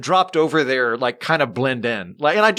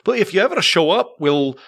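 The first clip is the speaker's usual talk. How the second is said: hesitant Scottish phone clerk, pitched up a little; Scottish accent; 3 semitones higher than usual. Retold or imitated. Distillery man answers a caller's drilling question, hesitant Scottish accent. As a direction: explain reluctantly in a Scottish accent. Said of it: "your Scottish accent was very well done".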